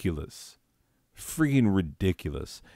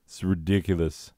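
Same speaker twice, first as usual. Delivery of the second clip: ordinary talk, nothing marked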